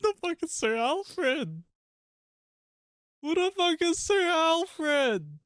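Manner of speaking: drawn-out, very high squawk; incredulous high wail; high-pitched outcry. Acted. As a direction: squeal the question slowly and loudly. Mock outcry at the stream.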